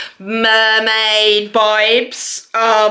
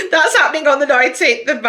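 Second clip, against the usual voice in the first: words bubbling with giggles; giggly announcement of a date; laughing through words; 4 semitones higher, faster than usual. Real, not acted. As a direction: talk while still laughing. Still laughing.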